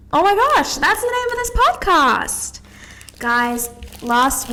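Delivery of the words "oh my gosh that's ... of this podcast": much higher, louder burst of excitement; giddy high-pitched exclamation; excited realisation. Real, not acted. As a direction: squeal it out as the idea lands. Excited realisation of podcast title.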